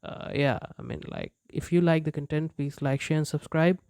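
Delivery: usual speaking voice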